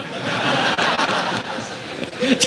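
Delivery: low airy chuckle; soft laugh. Real, not acted. Preacher chuckling after the punchline, low airy laugh. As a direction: chuckle under the breath.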